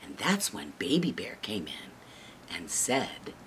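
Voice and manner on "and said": ordinary delivery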